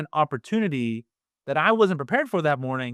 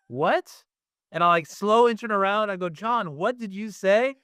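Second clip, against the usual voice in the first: retold parental disbelief; 6 semitones higher than usual. Dad reacts to son's swearing.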